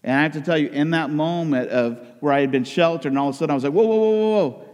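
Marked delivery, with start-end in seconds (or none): 3.7-4.6 high, loud run of whoas; startled halt. Retold or imitated. Sheltered young man reeling at what he learned, alarmed. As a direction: put the brakes on in alarm. Recalls being shocked by discoveries.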